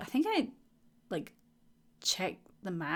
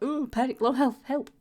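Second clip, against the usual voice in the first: higher, louder hammed-up panic; mock panic; 6 semitones higher, 5 dB louder than usual. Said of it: "sat here like".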